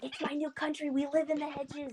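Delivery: high, eager hushed-secret voice; in-character excitement. Acted. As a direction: pitch it up, proudly playing along. Excited in-role answer to whisper.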